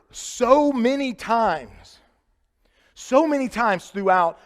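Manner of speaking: lingering stress on so; drawn out. Shown as a demonstration. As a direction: stretch it for emphasis. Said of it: "slowed emphasis".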